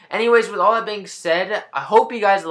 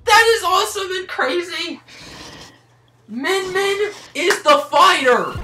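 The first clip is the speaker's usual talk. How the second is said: high-pitched awed exclamation; amazed excitement; 9 semitones higher than usual. Real, not acted. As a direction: marvel aloud in a high voice. Amazed at the revealed fighter.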